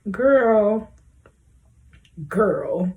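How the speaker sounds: normal talking voice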